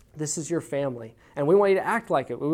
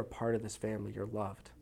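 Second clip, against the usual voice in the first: a soft, breathy whisper; hushed tender whisper; 5 semitones lower, 11 dB quieter than usual. Real, not acted. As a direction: let it land gently as a whispered blessing. Tender close to the point.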